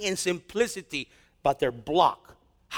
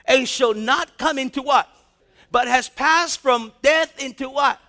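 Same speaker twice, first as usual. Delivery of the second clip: louder, call-and-response preaching; full-force preaching; 4 semitones higher, 7 dB louder than usual. Acted. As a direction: hammer the verse and throw the question to the congregation.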